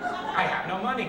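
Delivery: put-on zoned-out old woman, pitch lifted on a looping phrase; Aunt Lil. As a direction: repeat it like a confused nursing-home patient. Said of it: "my Aunt Lil would sit there like this".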